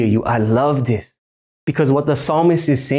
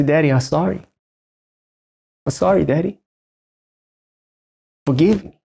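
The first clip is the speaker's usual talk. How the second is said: put-on a touch of a child asking forgiveness; lightly voiced childlike sorry; humble plea; 3 semitones higher than usual. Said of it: "and say".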